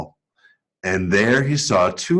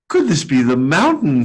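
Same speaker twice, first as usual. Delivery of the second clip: wondering teddy bear; 4 semitones higher than usual. A narrator's reading. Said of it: "he wondered".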